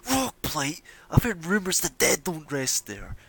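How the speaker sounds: the heckler cutting through the noise; shouting heckler. Acted. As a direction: shout over the noise in fear. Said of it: "the same guy again cuts through the noise".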